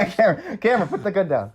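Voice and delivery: high, laughing negotiator voice; laughing mock plea. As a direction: giggle through a pretend plea. Joining the teasing negotiation.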